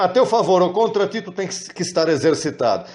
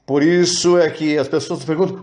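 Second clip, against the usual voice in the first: usual speaking voice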